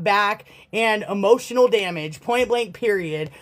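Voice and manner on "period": normal talking voice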